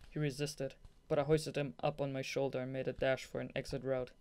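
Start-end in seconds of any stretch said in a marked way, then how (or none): none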